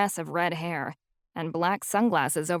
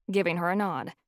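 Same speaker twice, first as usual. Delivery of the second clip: plain reading voice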